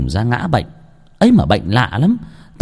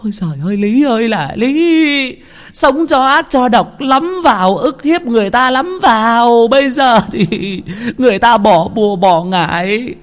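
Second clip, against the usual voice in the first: wailing wife; 12 semitones higher than usual. A narrator's reading. Wife kneels at the healer's feet, wailing lament. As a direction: wail and beg for your husband. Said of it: "Bà Lý khóc nức nở".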